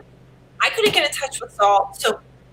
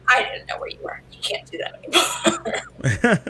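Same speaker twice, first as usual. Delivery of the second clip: whispered scolding; faster than usual. Retold or imitated. Scolding Saul for disappearing.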